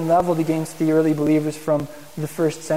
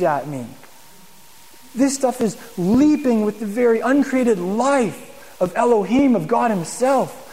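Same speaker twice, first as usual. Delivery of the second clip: awed reading; 5 semitones higher, slower than usual. Pretend. Imagined awed reader of Torah.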